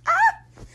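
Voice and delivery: a high, loud squeal of delight; overjoyed squeal at a sweet moment. Real, not acted. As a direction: let out a fangirl shriek. Squealing over Fang.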